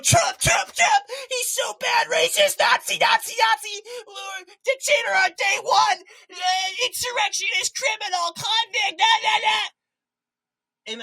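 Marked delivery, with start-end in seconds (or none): 0.0-9.7 shrieking, much higher and louder mock rant; mocking hysterical Democrats. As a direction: ham up a panicked screaming chant. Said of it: "Democrats are going".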